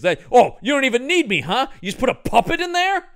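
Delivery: hammed-up offended complaint; indignant actor. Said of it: "he goes".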